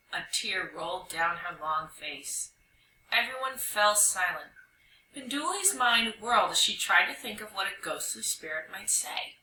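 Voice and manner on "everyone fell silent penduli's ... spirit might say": usual speaking voice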